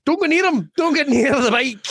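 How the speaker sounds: mock warning. Warning about a Glaswegian.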